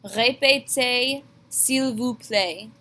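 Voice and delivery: Répétez, s'il vous plaît with rhyming ay endings, slow; teacher modelling for students; slow French demo. Shown as a demonstration. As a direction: let pétez and plaît rhyme. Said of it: "Répétez and play rhyme with each other".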